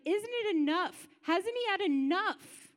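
high, loud, drawn-out cry of outrage; inner scream. Retold or imitated. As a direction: cry out at God, pleading and furious. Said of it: "just screaming in my head".

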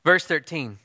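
ordinary delivery

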